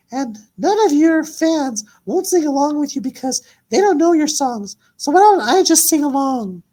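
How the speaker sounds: high-pitched mocking diss voice. Invisible Queen dissing [name] in a high taunting voice. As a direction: taunt the old diva from way up high. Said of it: "Invisible Queen says".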